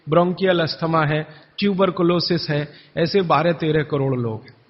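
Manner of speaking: usual speaking voice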